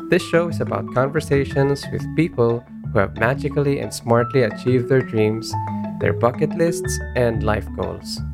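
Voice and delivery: upbeat host intro over music; bright radio-style welcome; podcast intro. Acted.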